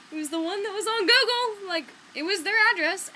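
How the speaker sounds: high, loud panicked protest; freaking out. Retold or imitated. Insisting to her friend about the address, panicked. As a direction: insist it was the right address, flustered. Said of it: "so we're freaking out".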